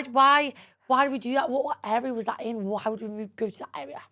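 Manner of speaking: normal talking voice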